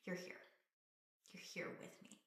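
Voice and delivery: put-on quiet hushed child; hushed relief. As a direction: whisper it as the calmed child. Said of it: "They just".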